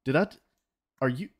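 ordinary delivery

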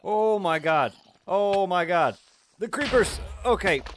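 high, loud panicked exclamations; alarmed cry. Real, not acted. Gamer swarmed by creepers, crying out high and panicked.

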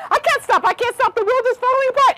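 very high, hurried rabbit squeal; screaming panic. A narrator's reading. Fleeing rabbit answering another rabbit, screaming panic. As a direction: squeal it while fleeing in mock terror. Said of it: "she kept on screaming".

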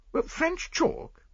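slow, high rising disbelief on French chalk; high, drawn-out surprised question; incredulous echo. Acted. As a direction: repeat it as if it makes no sense. Hastings echoes in disbelief.

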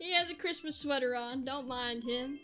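usual speaking voice

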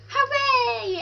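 hammed-up joyful hooray; big cheer. Celebrating controller working.

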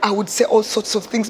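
normal talking voice

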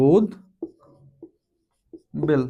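উদ বেল broken in two, "ud ... bel", long pause between; ব kept audible. Shown as a demonstration. Teacher showing class how it is pronounced, উদ বেল split with the b sounded. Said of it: "আমাদের এটার উচ্চারণ কেমন হবে?".

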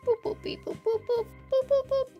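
high, even little boops; alien beeping. Pretend. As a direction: beep like a tiny alien.

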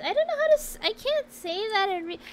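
high, loud, flustered squeal; squeaky, overwhelmed refusal; flustered protest. Real, not acted. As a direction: protest in a panic at being put on the spot. Flustered by request for voice impression.

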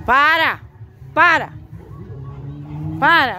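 loud repeated stop orders; firm commands. Real, not acted. Owner orders the dog to stop chasing, loud and firm.

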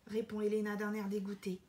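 ordinary delivery

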